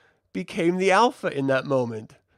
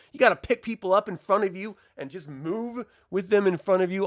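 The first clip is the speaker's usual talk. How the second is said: ordinary talk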